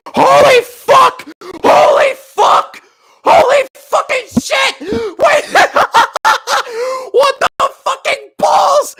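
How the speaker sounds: high, loud screaming disbelief with laughter; real ecstatic shock. Real, not acted. Streamer surprised by a big raid, screaming in shock. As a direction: shout it out high, completely blown away.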